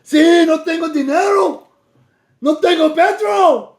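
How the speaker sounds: put-on high-pitched, animated Mexican accent. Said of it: "That's my Mexican accent".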